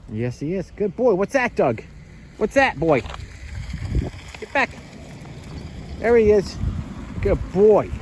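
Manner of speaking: high, loud gushing pet voice. Real, not acted. Owner praising Doug after he handled things, high loud pet voice. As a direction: gush over the dog like a proud owner.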